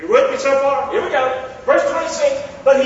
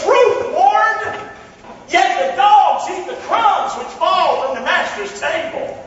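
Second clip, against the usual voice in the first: pleading woman; 9 semitones higher, slower than usual. Acted.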